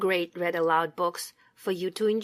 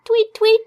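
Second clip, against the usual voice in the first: high chirpy tweet tweet; tweeting bird; 13 semitones higher, 6 dB louder than usual. Pretend. Bird sound in picture book.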